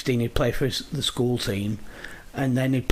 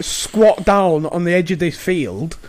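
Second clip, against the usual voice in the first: ordinary talk, nothing marked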